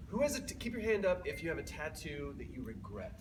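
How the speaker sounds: ordinary talk, nothing marked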